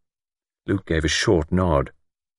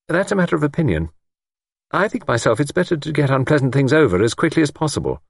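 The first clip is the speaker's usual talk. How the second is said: high-pitched, brisk rebuttal; shortly; 8 semitones higher, faster than usual. A narrator's reading. Young woman rebuffs older woman's reproach, shortly. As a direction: close the argument firmly. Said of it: "Bridget said shortly".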